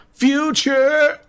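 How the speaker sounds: high, sung-out exclamation; exclaimed. Real, not acted. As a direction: belt the word out high. Echoes own word playfully.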